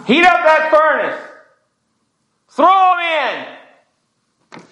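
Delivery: high, full-voiced shouted command; furious king. King ordering the boys into the furnace, furious high shout.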